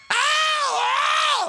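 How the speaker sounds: sudden loud ow; high pained yelp. Real, not acted. Streamer yelping at a scare, sharp and loud. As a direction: yelp as if stung.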